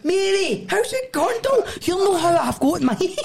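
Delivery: high, cheeky put-on greeting voice; mock greeting. Friend greeting Mary Berry in a teasing high voice. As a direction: greet her way up high like a starstruck fan.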